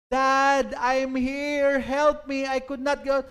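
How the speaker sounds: put-on high, loud frightened child's cry; a trapped little girl calling out to her dad in panic; daughter screaming for help. Trapped daughter calls to her father from upstairs, panicked high cry. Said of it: "his little daughter screaming the second floor".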